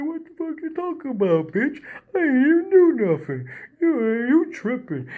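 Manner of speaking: very high, dragged-out cartoon-dog protest; Dopey Dog. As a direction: play a dopey dog denying everything, high-pitched. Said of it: "he sounds kinda like, uh, uh, Dopey Dog".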